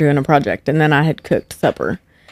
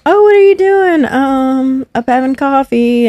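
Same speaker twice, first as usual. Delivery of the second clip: mock chirpy chit-chat; 7 semitones higher than usual. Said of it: "y'all are just over there".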